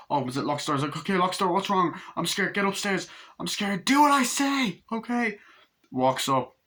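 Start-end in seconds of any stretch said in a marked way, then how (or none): 1.0-5.4 high, urgent voice; hammed-up scared exchange, rising to a cry; mock panicked orders. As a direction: imitate frantically ordering a child upstairs. Frightened exchange with child.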